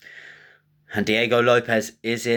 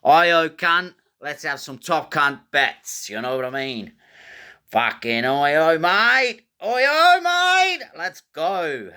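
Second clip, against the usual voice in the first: higher, louder, rowdy Australian bloke; mock Australian; 7 semitones higher, 3 dB louder than usual. Host announcing the underdog Aussie bets in a rowdy Australian accent. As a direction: ham up a boisterous Aussie accent. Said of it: "we're just speaking in Australian".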